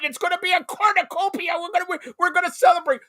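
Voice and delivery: high, loud, fast hype for the guest; excited hype. Real, not acted. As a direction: sell it like a party is coming. Hyping the guest appearance.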